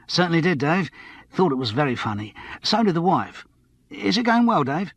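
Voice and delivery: a touch of eager pub-landlord chumminess; lightly voiced chatty barman. Barman greets TV celebrity customer, lightly voiced and chummy.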